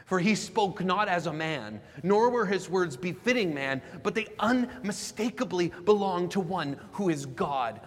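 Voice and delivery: ringing, emphatic sermon reading; impassioned preached quotation. Real, not acted. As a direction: proclaim each clause with force. Preacher declaims closing quotation.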